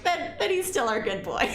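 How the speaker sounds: high, helpless laughing; breaking into laughter. Real, not acted. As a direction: crack up at your own joke. Jokes about murderous favourite.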